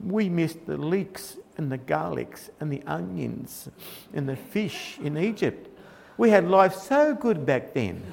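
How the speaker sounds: soft, slow, sulky moaning; mock whinge. Israelites pining for Egypt, hushed mock whinge.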